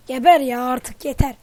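very loud enraged yell; furious shout. Real, not acted. Gamer bellowing at an enemy who won't die, furious. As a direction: bellow at the screen, at the end of your rope.

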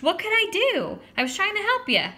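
very high, loud protest; indignant squawk; screeching. A narrator's reading. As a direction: screech it in self-defence. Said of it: "He screeched".

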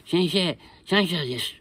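lightly voiced learner beaming at teacher; naive student. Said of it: "って言われちゃうわけよ".

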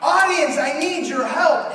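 loud, high plea to the crowd; calling out. Retold or imitated. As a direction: beg the whole audience for help. Pounding podium begging audience.

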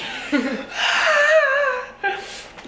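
very high, drawn-out mock shriek; hammed-up terrified scream; imitated scream. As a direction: shriek as if joining the panic. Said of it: "and Sarah follows. She's just like".

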